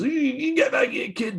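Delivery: high strained mock yell at a kid; angry big guy hollering. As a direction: yelp it as a flustered fat guy shooing off a kid. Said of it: "trying to be the guy who goes".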